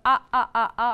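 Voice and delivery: loud quoted ah cries. Retold or imitated. Lecturer quoting the hero's cries of grief loudly. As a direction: punch out the cries. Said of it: "griefs of".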